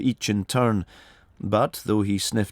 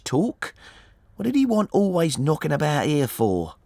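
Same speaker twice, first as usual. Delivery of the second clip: high, talkative woman's voice; disapproving boatman's wife; 7 semitones higher than usual. A narrator's reading. Wife complains about the wooden-legged visitor, disapproving. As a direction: grumble in a lifted character voice.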